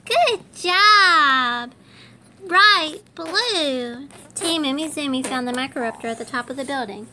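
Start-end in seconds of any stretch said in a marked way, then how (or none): 0.1-4.1 big higher, louder praise; praising the viewer for counting, bright cheer. Acted. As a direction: cheer the kids on.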